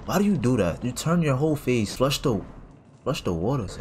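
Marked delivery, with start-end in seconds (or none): none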